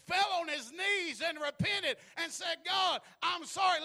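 loud, high, rising preacher's cry as the story peaks; preaching at full force. Acted. Preacher telling the congregation the story at its climax, shouting. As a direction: let the climax of the story carry you into a shout.

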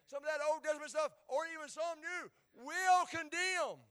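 loud, high, building preacher cry; fervent preaching. Acted. Pastor driving the point home to the congregation, rising fervor. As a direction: preach it up to the rafters.

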